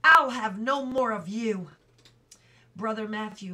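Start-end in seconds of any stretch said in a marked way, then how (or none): 0.0-1.7 very loud angry yell; furious shout. A narrator's reading. Boy yelling after throwing the broken piece, furious. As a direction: throw the words away in rage. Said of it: "he shouted".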